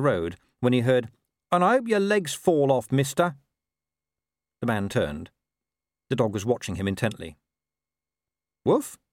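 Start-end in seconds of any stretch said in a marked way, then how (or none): 1.5-3.4 high, cheeky dog voice; grumbling talking dog. A narrator's reading. Dog cursing the man who gave a washer, high and sour. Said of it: "said the strange voice". 8.6-9.0 high, questioning little woof; feigned bark. A narrator's reading. Dog covering up before the watching man, innocent questioning woof.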